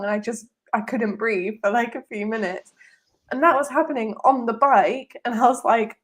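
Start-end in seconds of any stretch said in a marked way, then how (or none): none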